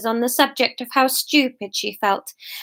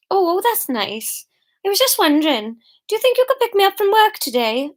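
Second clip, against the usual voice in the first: higher, lilting put-on sweetness; Fiona on the phone; 3 semitones higher than usual. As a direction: sweet-talk him down the phone. Voices Fiona's sweet phone call.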